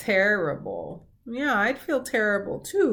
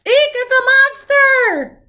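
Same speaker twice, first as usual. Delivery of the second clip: very high, loud slow shriek; screaming duck; 12 dB louder, slower than usual. Acted. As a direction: shriek like a panicked little duck. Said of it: "cried the duck".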